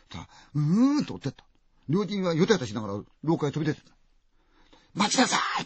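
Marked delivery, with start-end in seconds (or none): 4.9-5.6 high, louder call to stop; calling wait. Acted. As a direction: call after the fleeing patient. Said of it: "って言うんだけれども".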